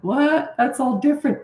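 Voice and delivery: a hint of a baffled mother; mom's voice. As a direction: play Mom as if she can't believe it. Mother's reaction retold, daughter answers.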